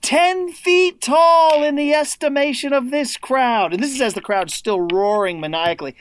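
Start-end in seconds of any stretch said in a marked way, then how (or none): 0.0-3.7 slow, high broadcaster's call; quoting the announcer's line in his voice; imitating a sportscaster. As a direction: stretch it out like a legendary commentator. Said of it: "he says".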